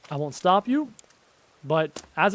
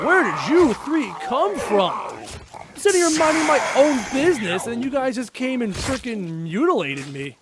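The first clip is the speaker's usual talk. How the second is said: raised, loud mock outrage; indignant; 5 semitones higher, 4 dB louder than usual. Scolding three attackers who ambushed him, mock indignant. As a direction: protest loudly like the wronged party.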